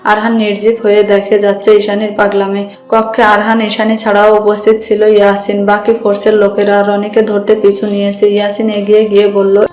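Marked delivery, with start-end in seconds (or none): none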